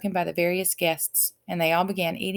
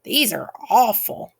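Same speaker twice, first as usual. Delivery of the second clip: disgusted bee; 4 semitones higher, 6 dB louder, slower than usual. A narrator's reading. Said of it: "tasting one".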